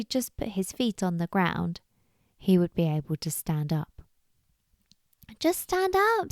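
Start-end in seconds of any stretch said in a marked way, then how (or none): 5.4-6.3 high-pitched urgent squirrel call. Acted. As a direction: call advice across the river. Squirrel calls to drowning bunny.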